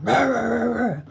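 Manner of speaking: high barking rah sounds like an angry police dog; imitated dog bark. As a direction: bark like a snarling guard dog.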